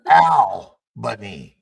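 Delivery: Albany with a slow, loud "Al" as in pal, then "buh-nee". Shown as a demonstration. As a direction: stretch and punch the first syllable of Albany, "Al", not "All". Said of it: "it's not A L L B A N Y".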